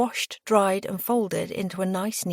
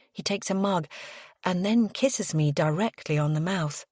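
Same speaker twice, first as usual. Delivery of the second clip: ordinary delivery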